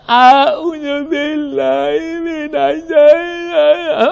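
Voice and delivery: slow, effortful recitation in a strained voice; halting reading. Shown as a demonstration. Preacher showing the congregation a struggling reader, slow and halting. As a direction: recite as if the words are hard to get out. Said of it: "kalau bacanya susah, terbata-bata".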